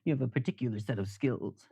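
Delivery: lightly voiced action-movie tough guy; a hint of a bad movie-star impression; Liam Neeson. Streamer parodying an action movie for chat, lightly put-on voice. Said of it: "shitty Liam Neeson movie".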